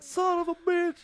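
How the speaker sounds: very high, slow, loud wail; mock dismayed dad. Dad hearing baby's first word, high mock dismay. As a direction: stretch it out high in mock despair.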